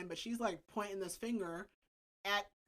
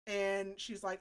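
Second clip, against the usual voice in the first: ordinary delivery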